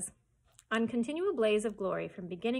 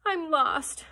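weeping; 7 semitones higher, 7 dB louder than usual. Acted. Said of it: "wept Oliver".

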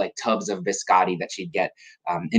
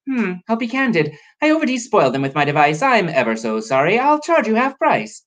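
higher, candid professor voice; Professor Biscotti; 10 semitones higher than usual. Acted. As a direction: offer a breezy put-on apology. Biscotti's apology in character.

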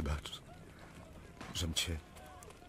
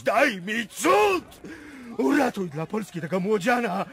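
shouted prayer; 10 semitones higher, 6 dB louder than usual. Acted. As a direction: hurl the plea at the sky.

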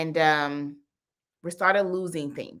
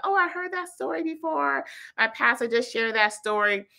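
lightly voiced higher, excited messenger; imitating a listener; 7 semitones higher than usual. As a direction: mimic a chatty commenter in a higher voice. Quoting people who messaged her.

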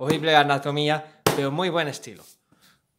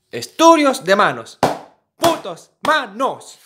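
exasperated; 9 semitones higher, 8 dB louder, slower than usual. Real, not acted. Exasperated at hand drawings.

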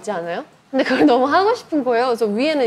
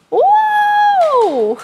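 excited exclamation; 7 dB louder than usual. Real, not acted. Game announced, she exclaims.

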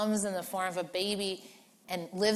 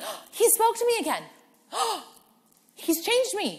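high, bright burst of delight; joyful wonder; 13 semitones higher, 3 dB louder than usual. Retold or imitated. Believer marvelling that God spoke again, high and joyful. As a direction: gasp it out like a thrilled believer.

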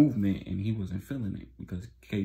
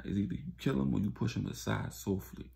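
usual speaking voice

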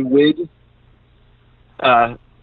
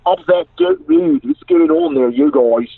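put-on Baltimore accent; 3 semitones higher than usual. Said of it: "a guy from Baltimore".

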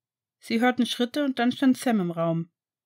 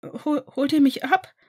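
high, louder trembling question; 6 semitones higher, 6 dB louder than usual. A narrator's reading. Sam asking his fathers if they take him home, trembling and high. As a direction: ask with hope and fear. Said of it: "wollte Sam zitternd wissen".

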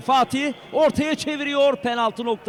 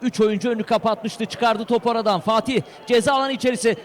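usual speaking voice

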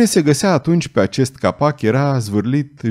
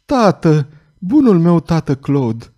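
higher, lightly voiced daughter; slow, higher-pitched emotional murmur; 6 semitones higher, slower than usual. A narrator's reading. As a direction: breathe it out in tender recognition. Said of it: "Ea întinse brațele și murmură".

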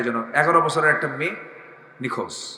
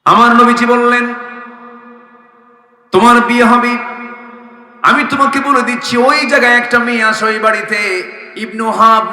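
full-voice preaching; 9 semitones higher, 10 dB louder than usual. Acted. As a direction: preach it out loudly on a raised, sustained pitch. Preaches in a loud chant.

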